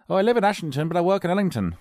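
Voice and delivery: high-pitched gossipy neighbour voice; local villager. Acted. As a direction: act out village small talk. Acted chat between local villagers.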